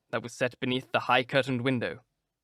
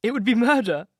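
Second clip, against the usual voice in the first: high, loud desperate cry; 8 semitones higher, 5 dB louder than usual. A narrator's reading. Dorian to Basil, stopping him ripping the painting, crying out. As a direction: stop his hand before he cuts the canvas. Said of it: "he cried".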